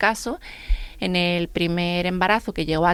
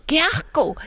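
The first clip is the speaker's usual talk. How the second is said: high, mock disgusted kid; imitating an eleven-year-old going ew; kid's disgust; 8 semitones higher, slower than usual. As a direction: squeal it like a grossed-out child. Disgusted child in class.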